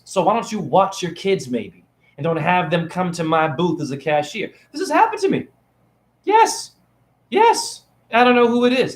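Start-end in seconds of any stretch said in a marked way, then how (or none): 4.7-8.9 much higher, squeaky and indignant; pitched-up outraged yes yes; high exasperated squeal. Real, not acted. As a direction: let it shoot up into a squeal of disbelief.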